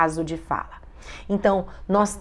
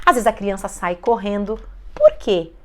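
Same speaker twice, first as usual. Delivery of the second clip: ordinary delivery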